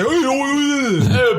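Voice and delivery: put-on drawn-out, high New York accent; hammed-up New York accent. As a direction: stretch it out like a caricature New Yorker. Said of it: "that's how New York people talk".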